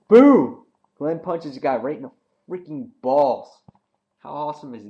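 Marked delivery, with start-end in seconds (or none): 0.1-0.6 much higher, much louder burst; excited boom. Real, not acted. Reviewer hyping the punch, much higher, much louder. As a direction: shout it like the big hit.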